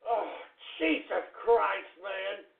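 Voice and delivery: ordinary talk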